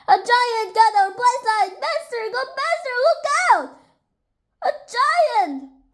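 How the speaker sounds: high, squealing, urgent alarm; acted panic. A narrator's reading. Rabbit urging Lion to flee a giant, high panic. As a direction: cry out as if fleeing a monster.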